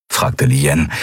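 plain reading voice

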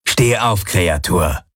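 sharp barked order, a touch harsher; snapping at him. A narrator's reading. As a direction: bark the command down at him. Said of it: "herrschte Knochenfresse ihn ... an".

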